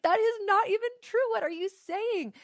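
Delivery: high, disbelieving, slowing on the question; mock disbelief pitched well up; incredulous retort. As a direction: scoff at a crazy claim. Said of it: "I'm like".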